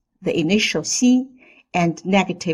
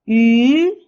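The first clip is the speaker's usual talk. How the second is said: "yu" loud, gliding up; rising tone; 4 semitones higher, 6 dB louder than usual. Shown as a demonstration. Teacher leads repeat drill, rising yu. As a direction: lift "yu" steadily to high. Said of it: "The second tone is the rising tone".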